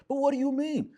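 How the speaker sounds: high, loud mock protest; matching high-pitched voice. Man matching his upset woman's voice, high-pitched. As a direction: throw her high pitch back at her. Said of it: "back and forth with her in that voice".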